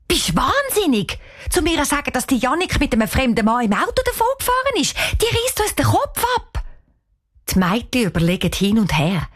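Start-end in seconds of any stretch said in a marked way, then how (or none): 0.1-6.6 much higher, fretting refusal; picture the aunt's fury and refuse; lightly voiced scared girl; alarmed girl. Girl rejects waking aunt.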